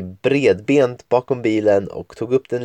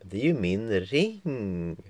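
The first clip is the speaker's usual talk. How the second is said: sulky; 9 dB quieter, slower than usual. Acted. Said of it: "Angie skrapade med foten".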